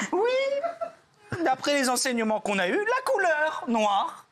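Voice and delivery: high voice cracking with laughter; laughing through it. Acted. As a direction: lose it mid-sentence, giggling high. Rabbi breaking into laughter.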